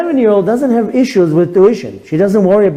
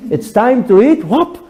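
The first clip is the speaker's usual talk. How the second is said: put-on child's amazement; 11 semitones higher than usual.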